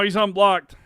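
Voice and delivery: ordinary talk, nothing marked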